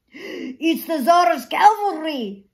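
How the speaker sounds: put-on old man warning of the cavalry; alarmed cry. Cavalry approaching.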